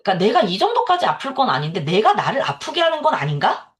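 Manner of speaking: normal talking voice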